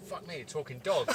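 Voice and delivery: sudden high gasped exclamation; astonished. Retold or imitated. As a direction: gasp it out in disbelief. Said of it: "look at each other and go".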